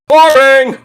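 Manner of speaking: loud, high-pitched jeer; heckling shout. Real, not acted. Bored fan heckles Dominic against The Miz, loud jeer. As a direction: yell it out like a heckler in the stands.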